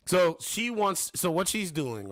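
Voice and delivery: ordinary talk, nothing marked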